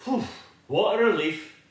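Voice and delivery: plain reading voice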